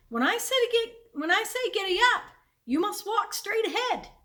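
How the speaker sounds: put-on high, loud boy instructing his calves; insistent, high-pitched order; firm command. A narrator's reading. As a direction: raise your voice high and lay down the rule.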